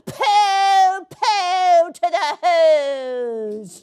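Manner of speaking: high, drawn-out mocking Grinch voice; gloating Grinch. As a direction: sneer it slowly like a gleeful villain. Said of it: "grinchishly humming".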